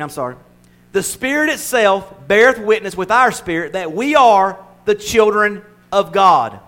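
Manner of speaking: ordinary talk, nothing marked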